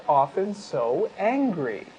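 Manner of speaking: usual speaking voice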